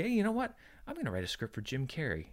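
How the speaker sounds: musing filmmaker. Retold or imitated. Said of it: "a Quentin Tarantino type filmmaker to say".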